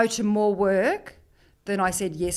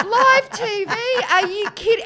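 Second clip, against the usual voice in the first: very high, loud disbelief; hammed-up freak-out; retold panic; 12 semitones higher, 5 dB louder than usual. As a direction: squeal it in comic horror at the prospect. Retold panic about live TV.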